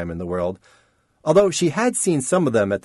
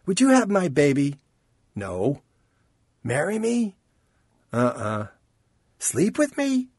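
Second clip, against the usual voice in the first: higher, playful mock proposals; teasing flirt; 5 semitones higher than usual. Chance jokingly flirts with Rain, high and teasing. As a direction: toss off joking come-ons.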